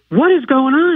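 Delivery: high-pitched retold exasperation; exasperated demand. Patient confronting the tech on her second return, exasperated. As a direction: demand an explanation, pitched well up.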